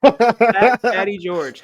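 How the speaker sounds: slow, loud ring-announcer intro; announcer reveal. Pretend. Host presenting his co-host to the live audience, announcer style. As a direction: stretch the name out like introducing a fighter.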